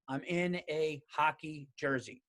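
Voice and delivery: "I'm in a hockey jersey" slow, each word spaced out; "I'm in a hockey jersey" as a slow, measured admission; deliberate repeat. Real, not acted. Deliberate repeat after "okay?.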